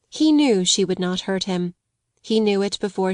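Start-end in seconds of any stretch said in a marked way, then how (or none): none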